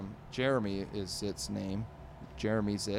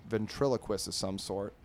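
ordinary delivery, nothing marked

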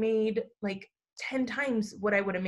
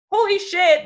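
amazed exclaim; 8 semitones higher, 9 dB louder than usual. Real, not acted. Realizing how life turned out.

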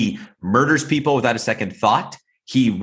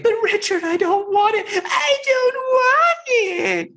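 very high, slow, shouted fake sobbing; hammed-up crying falsetto; mock crying plea; slower than usual. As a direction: wail it high and slow, mocking her tears. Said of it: "he does that to her".

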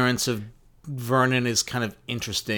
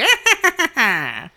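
loud high laugh; high burst of laughter; 6 dB louder than usual. Real, not acted. Laughing at own joke.

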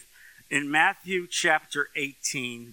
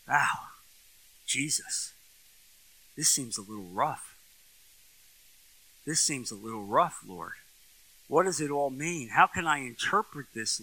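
hushed, wondering prayer aloud; quiet prayer; 5 semitones lower, 6 dB quieter than usual. Acted. Preacher quietly asking Jesus what the parable means, hushed prayer. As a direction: speak low and searching, as if asking the Lord.

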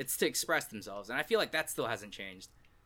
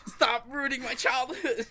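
laughing protest; 11 semitones higher, 3 dB louder, slower than usual. Real, not acted.

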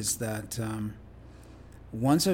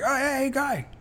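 much higher, cheery phone greeting; imitating Ron, cheery; 12 semitones higher than usual. Guest imitating Ron answering his call, much higher and cheery. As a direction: greet him brightly like an old friend. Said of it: "he's like".

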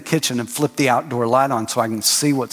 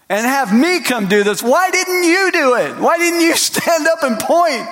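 high, slow, drawn-out disbelief; exasperated rebuke; 10 semitones higher, 3 dB louder, slower than usual. Retold or imitated. Jesus scolding the disciples after the storm, exasperated. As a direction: stretch each word like a let-down teacher.

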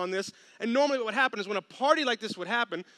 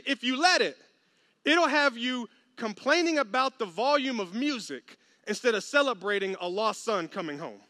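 ordinary talk, nothing marked

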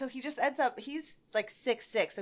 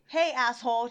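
a bit louder, rude mock hail; 2 semitones higher, 7 dB louder than usual. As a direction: lightly act out a rude customer. Acting out a rude customer.

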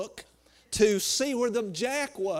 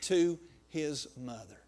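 rising, rolling preacher's cadence; full-force preaching; 4 semitones higher, 3 dB louder than usual. Acted. Pastor preaching the miracle to his church, full force. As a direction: preach it out to the congregation.

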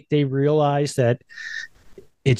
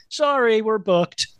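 high curt brush-off; imitating Pee-wee; 8 semitones higher than usual. Pee-wee turning Whoopi away, a high curt brush-off. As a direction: chirp the refusal, breezily dismissive. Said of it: "He's like".

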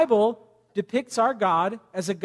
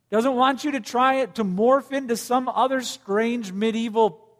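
ordinary delivery

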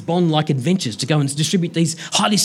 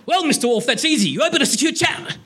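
much higher, smug Captain Picard; the captain confidently missing the obvious; high, fast Picard impression, self-assured; 10 semitones higher than usual. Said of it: "My apologies for the voices".

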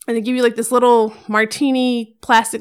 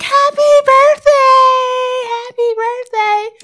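high, slow, drawn-out mom holler; cheering mom; 6 dB louder, much slower than usual. Retold or imitated. Imitating mom in the background at giraffe feeding, cheering. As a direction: stretch it out as a squealed cheer.

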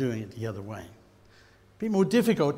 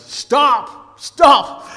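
crowd yelling stop; 12 semitones higher, 15 dB louder than usual. Retold or imitated. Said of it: "What the crowd was yelling was".

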